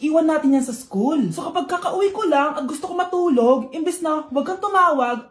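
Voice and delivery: normal talking voice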